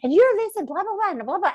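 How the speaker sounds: high, eager voice of a fan friend; imitating Johnny. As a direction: play Johnny gushing over her. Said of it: "Johnny comes up and he goes".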